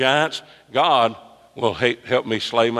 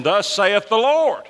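prophetic proclamation; 9 semitones higher, slower than usual. Acted. Preacher declaims a prophetic formula.

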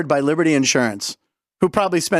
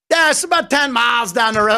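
higher-pitched Pittsburgh cabbie; Pittsburgh accent; 6 semitones higher than usual. Retold or imitated. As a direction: imitate a cab driver's broad Pittsburgh accent. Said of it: "We have this accent in Pittsburgh".

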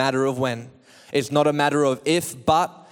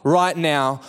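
high, driving full-force sermon voice; impassioned preaching; 5 semitones higher, 4 dB louder than usual. Real, not acted. Preacher calls dead hopes to come out, impassioned preaching. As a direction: pile up the phrases and call out the commands.

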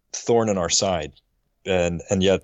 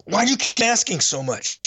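high, exasperated snap at his son; retold burst of impatience, voice pitched up; impatient outburst; 11 semitones higher than usual. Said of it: "I blurted out".

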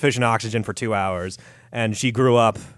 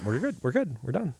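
muttered; 3 semitones higher, 8 dB quieter than usual. Retold or imitated. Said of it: "Walter and Watts were like".

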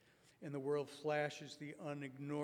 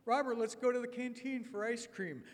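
put-on eager kid invite; 7 semitones higher, 7 dB louder than usual. Boys inviting Robert.

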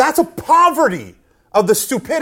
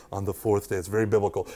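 very low and hushed; mock-solemn aside; 11 semitones lower, 10 dB quieter than usual. Speaker echoing his own biblical-sounding phrase, mock-solemn.

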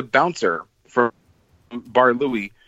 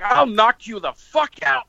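slow, pointed mock threat, pitched up; 10 semitones higher, slower than usual. After being slapped in the ear, slow threat. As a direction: spell it out slowly like a warning.

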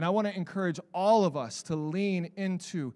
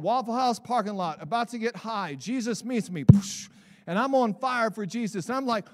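ordinary delivery

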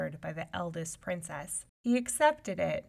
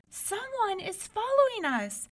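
much higher, scared warning; high-pitched frightened whimper; frightened cry; 4 dB louder than usual. A narrator's reading. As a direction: warn the sisters in fear. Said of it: "she cried".

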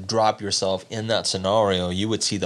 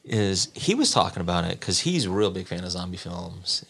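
ordinary delivery

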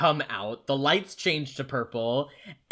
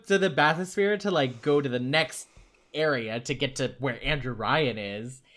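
normal talking voice

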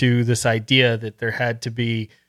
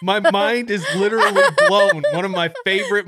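laughing; 7 semitones higher than usual. Real, not acted. Amazed reaction.

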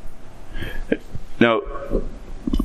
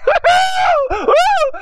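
joyful shouted laugh; 4 dB louder than usual. Acted. Freed servant overjoyed.

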